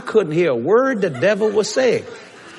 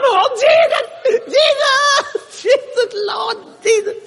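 joyful shouting; slower than usual. Acted. The three Hebrew children crying out in the fire, ecstatic. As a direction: call out to Jesus in ecstatic joy.

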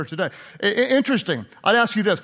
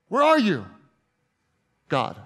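broken plea. Acted. Jesus asking where God is in his brokenness, forlorn. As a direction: ask it into silence, then drop away. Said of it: "in his greatest moment of brokenness".

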